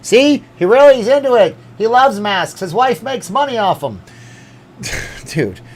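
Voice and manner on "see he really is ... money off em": high, loud mocking voice of the critics; hammed-up mock critic voice. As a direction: mimic the doubters teasing him. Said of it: "Everybody's gonna be like".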